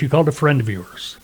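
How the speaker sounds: ordinary talk, nothing marked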